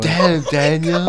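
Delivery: high, loud, drawn-out catchphrase; exaggerated high meme voice; meme imitation. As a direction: stretch both words in mock admiration. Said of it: "how that meme went".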